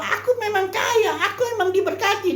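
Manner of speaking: mock their smugness with a laugh; mocking smug boast. Preacher mocking the prosperity church's smug boast with a laugh. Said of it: "dia sangat yakin".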